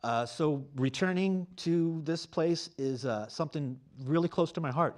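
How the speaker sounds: usual speaking voice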